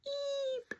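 high fading echoing eeps; distant mountain echo. Acted. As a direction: let each eep echo away. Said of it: "echoed from the mountains".

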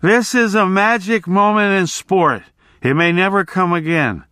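high, declaiming pronouncement; authoritative drunk. A narrator's reading. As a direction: proclaim it grandly from the barroom floor. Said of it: "still speaking with definite authority".

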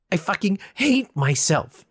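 loud, high anguished scream; screamed confession. A narrator's reading. Confessing self-hatred to the reapers, anguished scream. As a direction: tear it out of yourself. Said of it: "I screamed".

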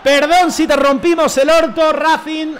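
loud, high, slow mock apology; gloating shout. Real, not acted. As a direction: crow it slowly at the beaten rival. Burla triunfal al rival. (burla triunfal a gritos)

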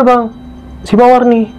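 normal talking voice

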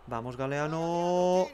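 long, rising stretched name; slow, suspenseful cheer; drawn-out urging. Real, not acted. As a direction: stretch the last vowel as the kick comes. Urging penalty taker on.

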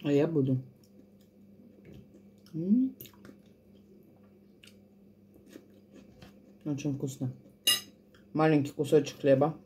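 talking while eating; speaking between bites; mouth full, chewing. Real, not acted. Seen 0: Face clear; chewing and forking food into mouth throughout the words.

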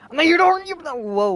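high, loud panicked yelp; startled shriek. Real, not acted. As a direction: jump back and shriek. Bear suddenly attacks.

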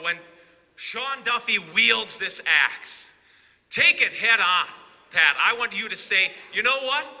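normal talking voice

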